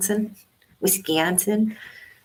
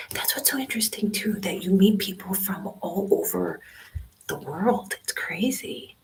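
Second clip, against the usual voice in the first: whispering; 3 semitones higher than usual. Real, not acted. Live in a quiet library.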